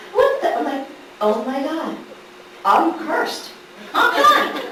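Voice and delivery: high, exasperated mock despair; comic indignation. Diner skipped by the waitress, comically indignant. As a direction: lament your bad luck in a high voice.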